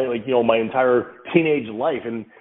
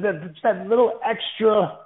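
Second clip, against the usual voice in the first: higher, drawn-out little lift of the voice; dad tone; 9 semitones higher than usual. Shown as a demonstration. Father showing the tone that tells kids he's serious, dad tone. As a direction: stretch it slowly with a parental edge. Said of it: "I call it the dad tone".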